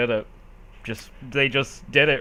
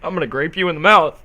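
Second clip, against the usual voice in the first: fast mock threat; 6 dB louder, much faster than usual.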